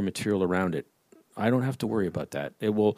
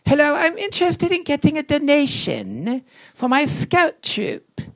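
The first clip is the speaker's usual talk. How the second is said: high, odd squeaky scoutmaster voice; 5 dB louder than usual. Retold or imitated. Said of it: "And he said to Harry".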